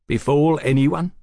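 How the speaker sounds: scornful echo, thrown back; challenging. A narrator's reading. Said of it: "Velasco challenged".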